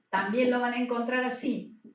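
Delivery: usual speaking voice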